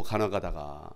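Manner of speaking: usual speaking voice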